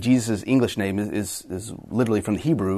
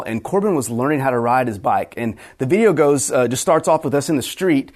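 ordinary talk

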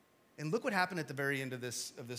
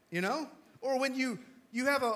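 ordinary talk, nothing marked

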